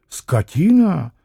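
slow, contained echo of an insult; drawn-out challenging question; scornful echo. Acted. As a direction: throw the word back, unhurried. Landlord throws the insult back.

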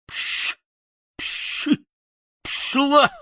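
whispered hiss rising to a high yell; hissed then shouted shoo. Acted. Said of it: "закричал я". (Страшила шипит и кричит на ворону)

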